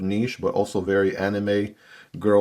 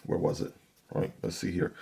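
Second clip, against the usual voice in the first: muttered aside; 8 dB quieter than usual. Real, not acted. Fumbling with knife, to himself.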